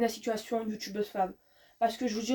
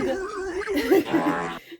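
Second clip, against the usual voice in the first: laughing; 8 semitones higher, 5 dB louder than usual. Real, not acted. Laughs at her own voice.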